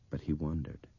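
plain reading voice

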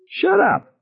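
very high, strained yell; acted outburst, pitch shooting up. A narrator's reading. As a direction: yell it at her in a panic. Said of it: "Ted yelled at her".

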